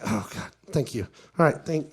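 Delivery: low, hushed thanks under his breath; whispered, low relief. Retold or imitated. Relieved at director's help.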